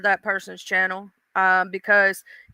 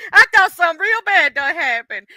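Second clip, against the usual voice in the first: laughing relief; 7 semitones higher, 4 dB louder than usual. Real, not acted. Relief after scare.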